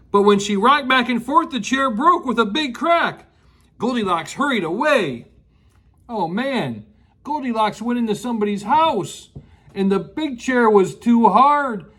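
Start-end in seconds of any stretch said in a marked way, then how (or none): none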